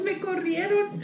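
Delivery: high, slow, whimpering confession; hammed-up sad little whine; mock tearful. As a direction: own up pitifully, drawn out. Retold tearful confession to sister.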